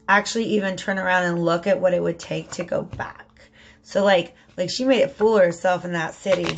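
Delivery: usual speaking voice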